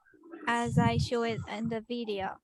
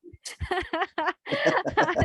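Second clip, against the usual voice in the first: teacher's laugh, a little higher in pitch; amused laugh; 4 semitones higher than usual. Real, not acted. Teacher reacting to a student's taka joke, amused laughing. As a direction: laugh openly for a couple of seconds.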